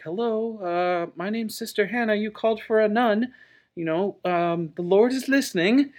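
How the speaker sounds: high, gentle mock nun voice; timid nun. Nun approaching a raving man in church, tentative. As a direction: put on a high, careful sister's voice.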